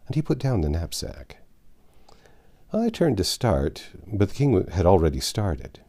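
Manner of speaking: plain reading voice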